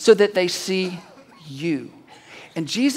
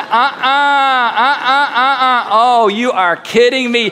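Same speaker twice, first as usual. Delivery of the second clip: balking onlookers; 3 dB louder, slower than usual. Acted. Onlookers refusing to approach Lazarus.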